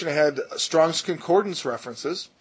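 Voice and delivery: normal talking voice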